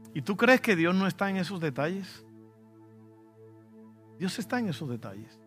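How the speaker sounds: ordinary talk, nothing marked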